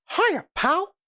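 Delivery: high squeaky cartoon mouse; chirpy falsetto greeting; Mickey Mouse voice. Shown as a demonstration. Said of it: "I could probably even do Mickey Mouse".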